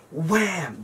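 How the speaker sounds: high-pitched fake punch word; mock impact. Storyteller faking the punch to trick viewers, playful exclamation. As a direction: throw the word out high, like a pretend hit.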